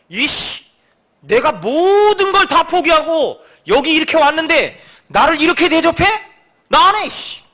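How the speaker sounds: loud, high huffy complaint; mock angry outburst. Missionary who gave everything, ranting about being mistreated. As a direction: storm off in a sulk.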